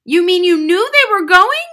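put-on high, loud incredulous child; cried out. A narrator's reading. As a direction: cry out in disbelief. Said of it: "Matilda cried".

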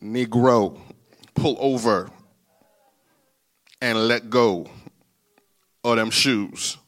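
deep, loud command in broken phrases; the Lord. Acted. The Lord ordering him to give up the shoes, deep and firm. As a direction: order it low, pausing between phrases. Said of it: "And the Lord's like".

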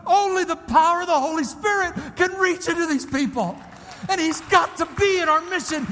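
loud, high, driving pulpit voice; impassioned preacher in full flow; full-force preaching. Real, not acted. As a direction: preach it with rising urgency, hitting the key words hard.

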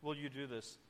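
softly submissive request, falling away at the end; humble prayer. Acted. David asks God if it is his will, humble. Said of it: "Again, that humility".

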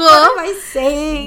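loud, slow, laughing question. Real, not acted. Laughing fit after losing track. (risa fuerte y lenta)

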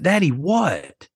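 father imitating his daughter asking what he wants; child's voice. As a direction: lightly voice a puzzled little daughter. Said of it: "She said".

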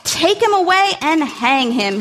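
king shouts to take Haman away; shouted. Acted. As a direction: roar the order at the guards. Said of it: "he shouted".

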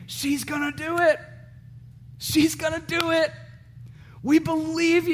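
put-on high, soft cheering for a toddler; encouraging parent. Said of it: "we'd always say the same thing".

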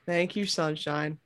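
soft, low thanks; tender. Acted. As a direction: say it softly and fondly.

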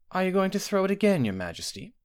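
high start, dropping on the title; taut smile. A narrator's reading. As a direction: ask warily through a taut smile. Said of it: "said the healer with a taut smile".

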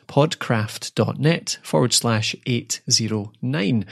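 each part slow and deliberate for the listener; deliberate URL read-out; slow spelled-out URL. Real, not acted. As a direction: spell the web address out slowly and clearly. Reading out show notes URL.

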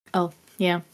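normal talking voice

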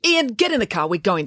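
sharp order that starts high; impatient, high-pitched command falling away; snapped order. A narrator's reading. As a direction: snap at your son to leave. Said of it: "snapped Mister Wainscott".

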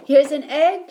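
put-on loud, high boyish shout. A narrator's reading. Boy shouts to his mother with the egg, loud and high. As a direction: call it out, proud of the egg. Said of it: "shouted Jack".

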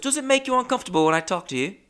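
quick, very high-pitched attempt at Australian; bad Australian accent. Gym acquaintance David asks a question, in a bad Australian accent. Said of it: "I can't do the Australian accent".

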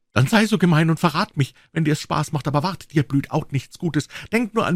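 put-on high, stamping, defiant girl's cry. A narrator's reading. Becky dares Tom to tell, high defiant cry. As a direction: dare him, near tears. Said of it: "stampfte sie mit dem kleinen Fuße auf und rief".

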